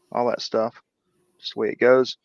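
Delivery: usual speaking voice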